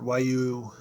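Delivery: ordinary delivery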